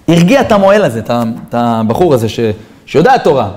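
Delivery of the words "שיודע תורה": ordinary delivery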